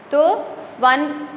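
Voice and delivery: normal talking voice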